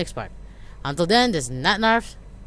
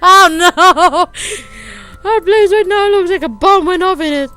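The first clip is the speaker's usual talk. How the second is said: panicked outburst; 11 semitones higher, 13 dB louder than usual. Acted. Panic over the messy house.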